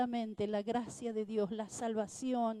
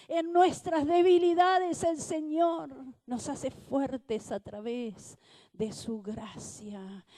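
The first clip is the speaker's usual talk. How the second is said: fervent preaching; 5 dB louder than usual. Acted. Preacher rousing the congregation, loud and fervent. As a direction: preach it to the congregation full force.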